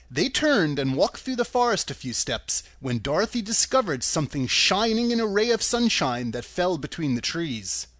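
ordinary delivery

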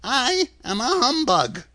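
high, gleeful admission; chirpy little old fellow; pleased little man. A narrator's reading. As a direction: confess it with delight in a high voice. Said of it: "as if it pleased him".

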